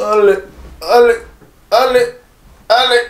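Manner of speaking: straining through sit-ups; doing sit-ups. Real, not acted. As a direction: push out each rep, strained. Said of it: "je peux faire les abdos".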